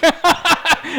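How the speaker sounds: burst of laughter. Real, not acted. Laughs at own spelling.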